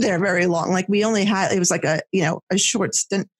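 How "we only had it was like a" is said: ordinary talk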